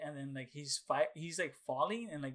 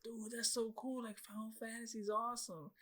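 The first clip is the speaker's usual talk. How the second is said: put-on awed kid; 8 semitones higher, 7 dB quieter than usual. Kid awed by the film scene.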